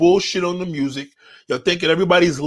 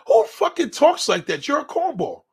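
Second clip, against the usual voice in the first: ordinary delivery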